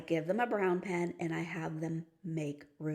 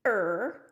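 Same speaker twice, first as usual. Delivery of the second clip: loud creaking "er"; creaking cry; 6 dB louder than usual. Shown as a demonstration. Teacher showing creaking branches, a loud creaking "er". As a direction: let "er" creak like a crying branch. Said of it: "Hear the tree branches".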